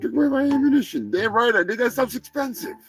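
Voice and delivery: high-pitched, indignant turkey voice; riled-up turkey character. Acted. Turkey grumbles to host about confiscated ammunition, high and indignant. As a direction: rant high as an outraged cartoon turkey. Plays Turkey Surprise, who refuses to calm down.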